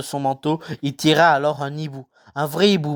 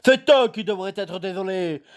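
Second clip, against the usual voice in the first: Hagrid voice; 7 semitones higher than usual. A narrator's reading. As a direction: play the kindly giant, unhurried. Hagrid voice held.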